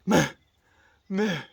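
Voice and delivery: short, high-pitched mock bark; imitated dog bark. Streamer imitating Bingo the dog's bark, short high yaps. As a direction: yap out a little imitation of a dog. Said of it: "Bingo barks like".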